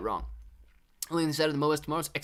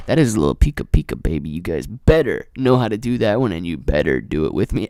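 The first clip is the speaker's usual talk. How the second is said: very loud, low hype voice; booming mock command; 6 semitones lower, 12 dB louder than usual. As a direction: bellow it like a showman giving orders.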